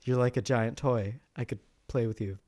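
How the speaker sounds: low, soft, mock-seductive croon. Teasing cohost about the robot, a low soft mock-seductive murmur. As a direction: tease a friend with a hammed-up lovesick murmur. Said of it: "J G goes to bed tonight and he's just like".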